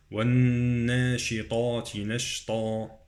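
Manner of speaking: slow recited "والناشطات" with humming nun; ghunnah, emphatic ta. Shown as a demonstration. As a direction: hold the nun and darken the ta in "والناشطات". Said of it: "أقرأها بغنة".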